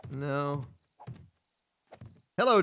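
usual speaking voice

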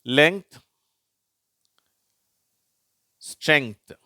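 isolated words said out clearly; model word reading. Shown as a demonstration. Teacher giving example words, model pronunciation. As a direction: read லெங்த், ஸ்ட்ரெங்த் with ng-th joined. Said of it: "வார்த்தைகளை பார்க்கலாம்".

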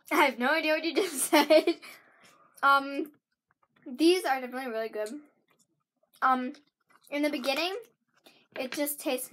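chewing between words; talking while chewing candy. Real, not acted. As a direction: mouth partly full of candy. Seen 1: Girl eats candy earlier; closed-lip chewing between words while talking.

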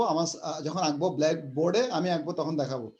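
usual speaking voice

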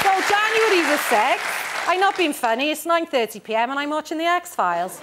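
mock Barry accent reading a teen diary. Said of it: "I can't do it in a Barry accent".

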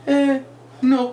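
hesitate, then give a small high no; great expression. Retold or imitated. Rabbi imitates the joke's guest declining seconds, high and hesitant. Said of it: "he puts up this great expression".

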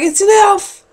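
stoned guy spotting an elf in the shop; shocked yelp. Retold or imitated. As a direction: blurt it out like you've just seen an elf. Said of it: "I'm like".

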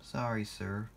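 soft, subdued apology; sighed. A narrator's reading. Thomas apologising to his boss, sighing softly. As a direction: give in quietly. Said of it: "sighed Thomas".

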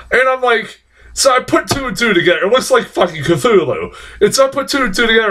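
worked up, high and fast; excited. Real, not acted. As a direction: rant with growing excitement. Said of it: "I got all worked up".

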